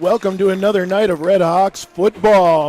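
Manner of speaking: loud, upbeat broadcast intro; announcer voice. Acted. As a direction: open the show like a sportscaster. Broadcast opening.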